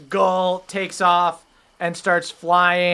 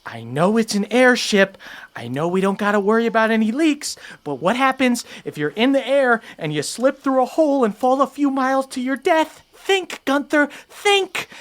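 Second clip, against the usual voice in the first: fast, higher worried mouse voice, rising to think; fussy mouse; 7 semitones higher than usual. Acted. Mouse folk lecturing Gunther about falling, fast fretting. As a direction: fret at the bear like a nervous little mouse. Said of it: "the mouse just goes".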